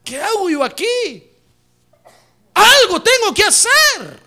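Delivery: high, loud, slow exasperated cry; bored churchgoer's outcry. Retold or imitated. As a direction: wail it like a bored man fed up. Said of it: "y va a decir".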